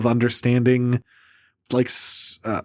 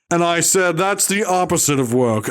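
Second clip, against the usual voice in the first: higher-pitched, hammed-up comic punchline; Bill Maher; 4 semitones higher than usual. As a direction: mimic a self-satisfied stand-up comic. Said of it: "Bill Maher, like you've never seen him before".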